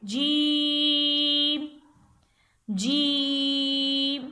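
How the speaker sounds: "जीम" with the vowel held very long, five alifs' worth; long drawn vowel. Shown as a demonstration. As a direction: stretch out "जीम" slowly, holding the long vowel. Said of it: "तो इसको हम खींच के पढ़ेंगे".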